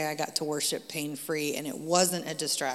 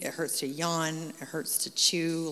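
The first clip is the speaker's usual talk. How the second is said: ordinary talk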